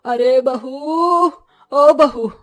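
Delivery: drawn-out call to the daughter-in-law; आवाज़ लगाना. Acted. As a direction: call out from another room, stretching the vowels. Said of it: "Saraswati आवाज लगाती है". (लंबी खींची हुई ऊँची पुकार)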